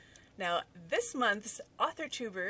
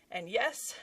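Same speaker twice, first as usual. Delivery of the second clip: usual speaking voice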